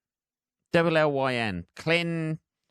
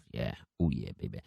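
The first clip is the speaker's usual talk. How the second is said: much lower and softer crooner voice; mock smooth lover; 8 dB quieter, faster than usual. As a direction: purr it like a hammed-up seducer. Mock sultry voice on radio.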